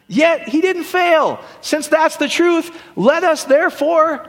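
high, slow, ringing preacher voice; preaching scripture. Acted. Pastor reading Hebrews to congregation, slow ringing proclamation. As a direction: proclaim it slowly from the pulpit.

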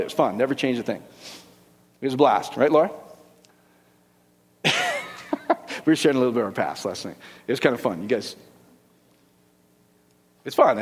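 ordinary delivery

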